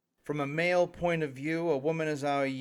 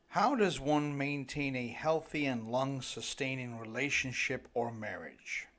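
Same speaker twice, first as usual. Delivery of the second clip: ordinary talk, nothing marked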